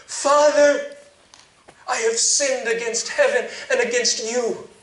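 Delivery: high, loud, pleading confession; pleading to be forgiven; loud plea. Acted. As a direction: cry out your guilt to your father. Boy rehearses his plea to father.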